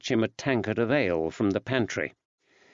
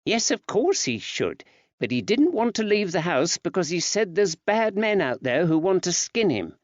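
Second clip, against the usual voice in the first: woman's voice; 8 semitones higher than usual. Acted. Betsy explaining.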